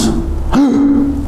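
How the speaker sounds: sharp theatrical gasp; shocked gasp. Acted. Host acts out losing parents while shopping, shocked gasp. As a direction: gasp in mock alarm. Acting out being lost.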